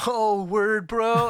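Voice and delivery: high, loud Californian bro caricature; mock Californian accent. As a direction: ham up the California bro talk. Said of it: "for us as Californians".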